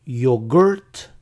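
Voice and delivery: "yogurt" as yo-GURT with rising tune; quiz option pronunciation. Shown as a demonstration. Teacher offers first quiz choice, "yogurt" as a rising yo-GERT. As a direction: say "yogurt" as yo-GERT, voice lifting at the end. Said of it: "¿Cómo se pronuncia yogurt en inglés?".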